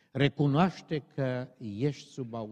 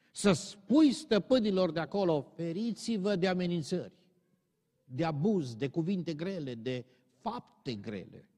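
raised, insistent pulpit voice; fiery preaching; 7 semitones higher, 5 dB louder than usual. Acted. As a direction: hammer the warning home to the masters. Preaching at full force. (predică aprinsă, cu voce ridicată)

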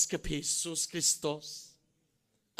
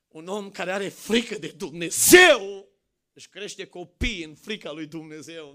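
fiery preaching; 2 semitones higher, 17 dB louder than usual. Acted. Preacher urging parents to save their households, full-force preaching. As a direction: thunder it from the pulpit, rising to shouts.

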